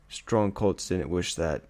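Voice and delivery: ordinary delivery, nothing marked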